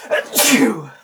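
a real, sharp sneeze. Real, not acted. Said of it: "She just sneezed".

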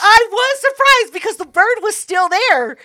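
loud, high-pitched protest; emphatic insistence. Real, not acted. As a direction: insist over the teasing. Insisting to a teasing sceptic.

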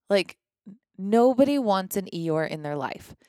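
ordinary talk

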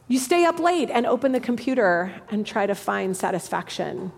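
normal talking voice

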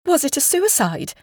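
high-pitched, anxious woman; alarmed question. A narrator's reading.

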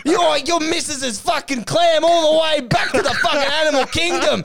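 high, loud theatrical boast; hammed-up shouting. [name] putting the young caller in his place, mock fury. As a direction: bellow it like an outraged king.

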